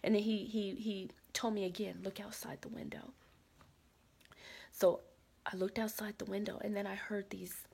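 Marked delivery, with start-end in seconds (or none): 2.0-3.1 soft unvoiced whisper, hushed and urgent. The guy whispers an urgent warning about approaching soldiers. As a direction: hiss it so the soldiers won't hear. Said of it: "started whispering".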